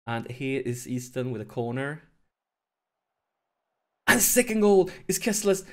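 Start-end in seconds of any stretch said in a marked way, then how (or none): 4.1-5.6 loud, high-pitched celebration; excited goal call. Real, not acted. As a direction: shout out the goal in a burst of excitement.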